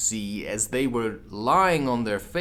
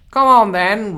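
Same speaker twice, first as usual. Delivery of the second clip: much higher, much louder roar; roaring Queen; 10 semitones higher, 13 dB louder than usual. Acted. The Queen roars at Alice to join. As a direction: roar it at her. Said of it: "roared the Queen".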